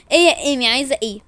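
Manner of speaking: ordinary delivery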